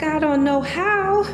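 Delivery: ordinary talk, nothing marked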